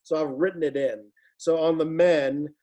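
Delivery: ordinary talk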